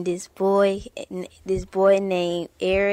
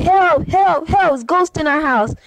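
calling for help; 7 semitones higher than usual. Acted.

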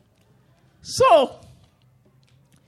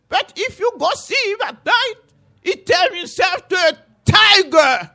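much higher, loud, urgent warning; Buki calling out; 7 semitones higher, 6 dB louder than usual. Acted. Buki warning his wife Malice about the calf, high rising alarm. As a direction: call her out, then build to a fearful shout. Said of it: "Buki called inside".

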